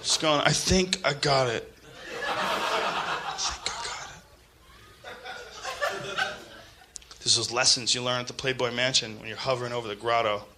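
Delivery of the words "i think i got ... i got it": quiet revelation. Pretend. Scott Baio having a revelation.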